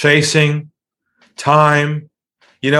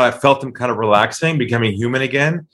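normal talking voice

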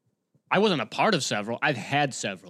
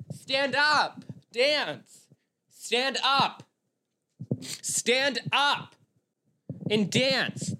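high-pitched imitation of screaming women; mock screaming; 10 semitones higher, much slower than usual. Wedding guests screaming at a bridesmaid to dance, mock screaming. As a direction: mimic pushy screamers in a high, strained voice. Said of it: "they were just screaming at this bridesmaid".

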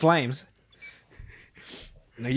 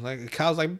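usual speaking voice

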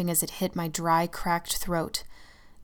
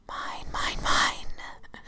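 possessive whisper; 3 dB quieter, much slower than usual. Acted. The shadow claims her.

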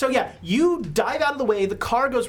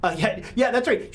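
ordinary talk, nothing marked

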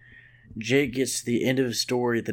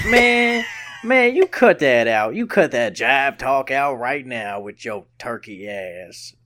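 hammed-up indignant jive scold; jive-talk scolding voice; 6 dB louder than usual. Podcaster telling off imagined critics, mock jive scolding. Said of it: "that's the voice I'm mad at you about".

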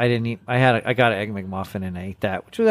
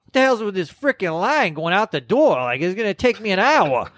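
higher-pitched mock griping; good-natured complaining; 6 semitones higher than usual. People queued out the door, playfully complaining. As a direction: imitate someone grumbling in good humor, voice climbing. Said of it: "kept complaining... in a kind of a good way".

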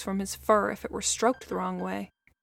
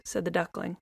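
usual speaking voice

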